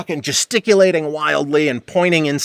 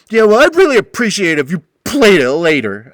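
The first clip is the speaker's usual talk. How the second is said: mock Butch voice; 4 semitones higher, 6 dB louder than usual. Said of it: "he's like".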